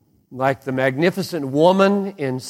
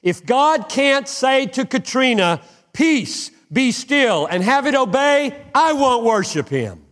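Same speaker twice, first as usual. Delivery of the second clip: much higher, ringing declaration; full-force preaching; 10 semitones higher than usual. Acted. Pastor challenging the crowd about Katrina, fiery preaching. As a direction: cry out the challenge with fire.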